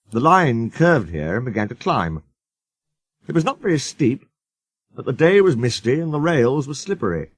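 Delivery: ordinary delivery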